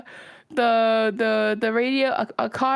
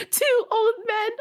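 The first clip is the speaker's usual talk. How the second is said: high, slow, whining plea; whiny sister imitation; 12 semitones higher than usual. Imitating her sister insisting on the clue, high and whiny. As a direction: whine it slowly like the nagging sister.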